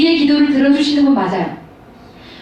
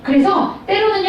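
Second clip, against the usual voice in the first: ordinary talk, nothing marked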